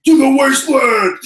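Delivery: loud raspy promo yell; grunge promo voice. Retold or imitated. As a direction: rasp it out like a wrestling promo. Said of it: "You did a perfect".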